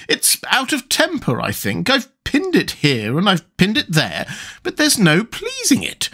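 high, doleful queen's complaint; melancholy. A narrator's reading. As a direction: lament helplessly, pitched well up. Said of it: "in a melancholy voice".

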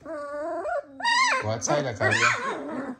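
slow high coaxing; slow high sing-song coaxing. Real, not acted. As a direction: coax her out, slowly, way up high.

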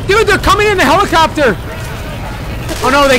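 high, loud frantic warning; alarmed shout. Real, not acted. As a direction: yell the news in a panic. Enemies reach the escape helicopter.

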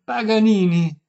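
high, very loud bellow; full-lunged shriek. A narrator's reading. Violinist at deaf old lady, full-lunged shout. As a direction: shout with all your breath. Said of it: "strillò Paganini con quanto fiato aveva".

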